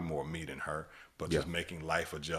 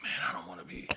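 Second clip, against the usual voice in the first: inner thought; 4 dB quieter, faster than usual. Retold or imitated. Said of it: "at one point I was like".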